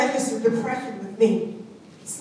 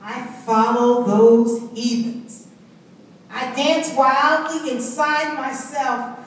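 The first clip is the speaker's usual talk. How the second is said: usual speaking voice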